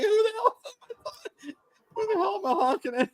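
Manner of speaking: high, soft, helpless giggly quote; laughing retold bafflement. Husband retelling his confused honking, helplessly laughing. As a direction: squeeze the question out through laughter.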